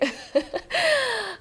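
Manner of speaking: high-pitched burst of laughter; amused laugh. Real, not acted. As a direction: crack up at your own slip. Laughs at own accent slip.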